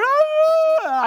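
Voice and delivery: high, loud bawling cry; scared kid howling after being yelled at; child's wail. Retold or imitated. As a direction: burst into a kid's wail. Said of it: "I just start bawling again".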